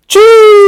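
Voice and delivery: a loud, high, explosive achoo; big sneeze. Acted. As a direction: blast out a huge cartoon sneeze. Gerald sneezes in story.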